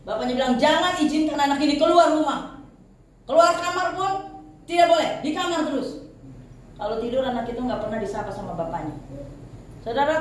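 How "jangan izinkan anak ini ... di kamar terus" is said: higher, louder stern father's order. Retold or imitated. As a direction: bark out a harsh father's command. Abusive father's orders voiced.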